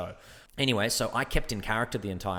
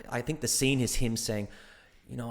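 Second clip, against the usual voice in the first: ordinary talk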